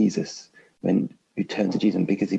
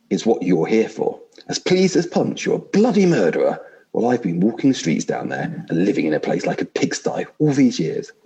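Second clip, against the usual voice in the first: higher, louder, aggrieved complaining ghost; a self-righteous ghost voice pushed up high; indignant big ghost; 4 semitones higher, 4 dB louder than usual. Acted. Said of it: "I'll try vaguely to put on different voices".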